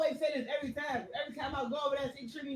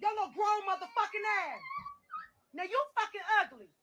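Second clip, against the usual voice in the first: angry yelling; 5 semitones higher than usual. Retold or imitated. Angry mother yelling at child.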